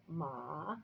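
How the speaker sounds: "ma" starting low and rising; second tone. Shown as a demonstration. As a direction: sweep "ma" up like a question. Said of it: "what the second tone sounds like".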